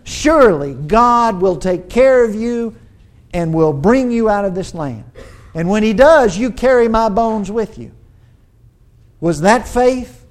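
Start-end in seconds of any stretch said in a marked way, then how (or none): 0.0-7.9 louder, with a firm certain ring; confident promise. Retold or imitated. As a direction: declare it as a sure promise. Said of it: "that confident statement".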